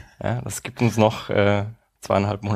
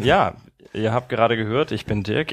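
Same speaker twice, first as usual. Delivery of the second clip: ordinary talk, nothing marked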